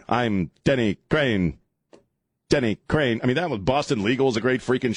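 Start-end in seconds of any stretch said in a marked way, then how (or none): 0.1-3.2 slow TV-character catchphrase, lightly imitated; Denny Crane. Host quotes Boston Legal, light Denny Crane impression. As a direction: separate each word of the name with weight. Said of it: "Then, uh, Denny Cr".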